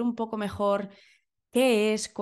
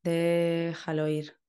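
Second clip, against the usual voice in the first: guided meditation. Acted. Leads a breathing meditation.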